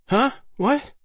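high-pitched startled female character voice; startled awake. A narrator's reading. Alice to Waldo as he shakes her awake, startled. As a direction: squeak it out, just shaken awake. Woken roughly from sleep.

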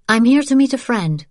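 put-on young woman voice. Isabelle voiced, high.

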